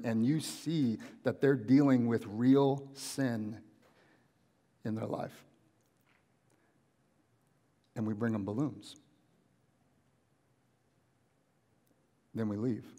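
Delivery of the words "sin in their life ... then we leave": low, soft, with long pauses; hushed and sombre. Real, not acted. Preacher on leaving the hurting with balloons, hushed and sombre. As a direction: drop right down and let each phrase land. Preacher lets the sad point land.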